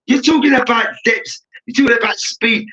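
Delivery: frustrated fan outburst. Retold or imitated. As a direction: voice the fed-up fans arguing back. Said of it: "the fans across the country is getting so frustrated".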